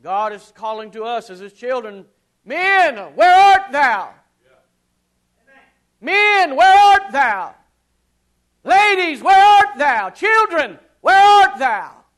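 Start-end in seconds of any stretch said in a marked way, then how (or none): 2.4-12.0 loud, high, slow cry to each group; booming divine call. Pretend. God calling men, ladies and children, booming. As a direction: call them out one by one.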